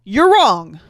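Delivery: sudden very loud mock rebuke; loud gotcha. Reader correcting listeners on underage drinking, shouting. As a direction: blast it out at the listener.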